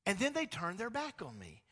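lightly voiced God recalling Israel; God speaking. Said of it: "And so he's like".

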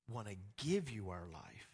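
ordinary talk

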